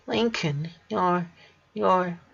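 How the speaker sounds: high, soft voice breaking on the words; can't get it out through the tears; tearful, choking up. Acted. Sister choking up at her dying brother, tearful. Sister too upset to finish.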